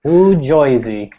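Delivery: put-on slow, drawn-out New Jersey accent saying 'Noo Joisey'; playful hammed-up New Jersey accent. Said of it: "that's you, Steven".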